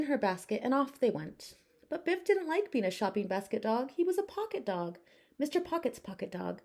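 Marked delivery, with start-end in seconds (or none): none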